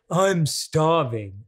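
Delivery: dragged out slowly, whole phrase; drawn-out complaint. Retold or imitated. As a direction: stretch the words, long and whiny. Acting out a self-talk phrase.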